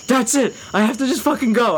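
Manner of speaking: high, hammed-up desperate decision; mock frantic resolve. Paranoid man needing an alleyway, mock frantic. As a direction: blurt it like you must flee. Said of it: "the most insane fucking person ever".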